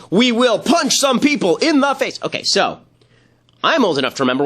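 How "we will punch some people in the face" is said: higher, slow, puffed-up heroic declaration; mock tough actor. Commentator mocking an actor's awards speech, puffed-up and slow. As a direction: ham up the actor's brave threat. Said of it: "the one that they're cheering where he says".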